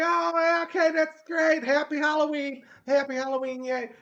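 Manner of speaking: high, loud mock cheer; humoring homeowner. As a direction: put on an overdone, high-pitched cheery homeowner. Voices homeowners humoring the trick-or-treater.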